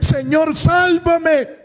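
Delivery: high-pitched, urgent plea; acted cry for help. Preacher re-enacting the man's cry to Jesus, acted cry. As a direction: call out high as the drowning Peter. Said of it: "al grito de este hombre".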